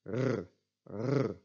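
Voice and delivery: uvular trilled "r"; back R. Shown as a demonstration. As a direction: roll the "r" on the uvula at the back of the throat. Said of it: "trilling your uvula".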